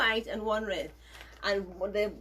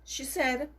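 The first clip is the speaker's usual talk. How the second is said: ordinary delivery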